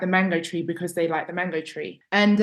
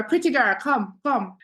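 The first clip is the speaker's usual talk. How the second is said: high, light Jamaican gran voice; calling sweetly like a Jamaican gran; 5 semitones higher than usual. Retold or imitated. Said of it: "She'd go".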